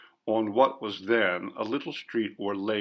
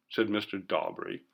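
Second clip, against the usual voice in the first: ordinary delivery, nothing marked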